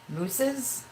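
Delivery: "Mooses?" asked high and uncertain, testing the plural; unsure plural. Real, not acted. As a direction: try out "Mooses?" as a doubtful question. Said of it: "Hopefully I said that correctly".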